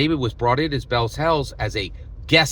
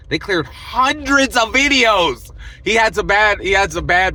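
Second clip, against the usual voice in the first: indignant emphasis; 9 semitones higher, 7 dB louder than usual. Real, not acted. Vlogger stressing to viewers how many were wiped, loud and high. Outraged emphasis about deleted videos.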